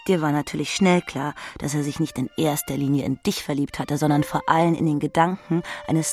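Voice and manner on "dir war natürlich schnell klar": normal talking voice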